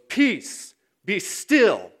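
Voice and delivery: loud, high shouted order; forceful command. Acted. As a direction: shout down the raging waves. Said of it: "looks at the waves and goes".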